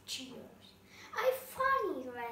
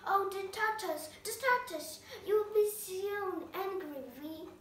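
a fussy, anxious rabbit muttering to himself, raised higher; 5 semitones higher, 5 dB louder than usual. A narrator's reading. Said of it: "the Red Rabbit is talking".

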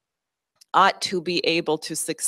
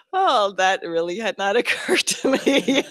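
laughing confession; 4 semitones higher than usual. Retold or imitated. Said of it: "And I said".

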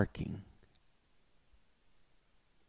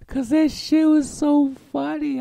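laughing; 9 dB louder than usual. Real, not acted. Laughing as he remembers.

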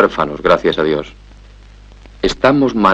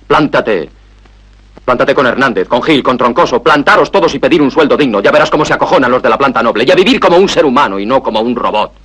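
tono agudo sostenido, encadenando frases; empújalo a rebelarse con vehemencia; arenga vehemente; 4 semitones higher than usual. Acted. Arenga a Andrés a plantarse. (arenga apasionada de un tirón)

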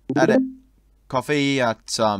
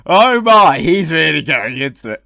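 laughing; 3 semitones higher, 8 dB louder than usual. Real, not acted. Laughing at a crude chat.

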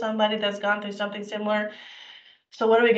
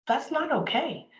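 ordinary talk

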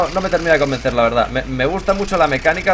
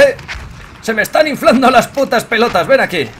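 ordinary talk

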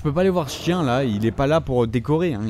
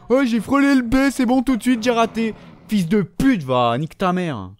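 furious yell; 6 semitones higher than usual. Real, not acted. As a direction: explode at the controller. Gamer raging at missed button. (hurler de rage contre le jeu)

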